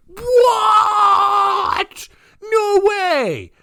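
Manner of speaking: drawn-out, high, loud mock gasp of disbelief; hammed-up astonishment. Followers hearing Susan is back, hammed-up amazement. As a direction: squeal it like a gullible crowd.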